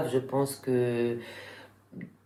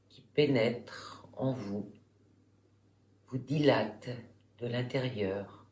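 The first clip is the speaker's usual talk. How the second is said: guided relaxation; 3 semitones lower, 8 dB quieter than usual. Real, not acted. Yoga teacher guiding listeners lying in Shavasana, calm meditation voice. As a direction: guide them calmly through the body, softer and lower, unhurried.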